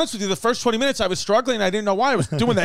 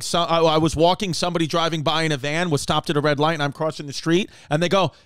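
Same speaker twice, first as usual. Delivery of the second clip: ordinary talk, nothing marked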